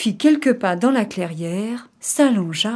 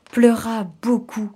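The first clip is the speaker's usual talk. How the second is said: ordinary delivery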